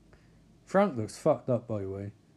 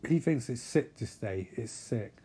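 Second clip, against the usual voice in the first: normal talking voice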